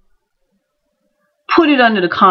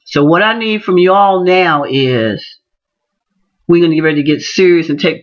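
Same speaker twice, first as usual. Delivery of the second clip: normal talking voice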